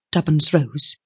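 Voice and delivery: usual speaking voice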